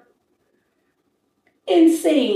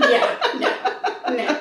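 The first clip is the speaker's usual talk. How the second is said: laughing; 3 semitones higher, much slower than usual. Real, not acted. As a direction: talk through real laughter. Still laughing.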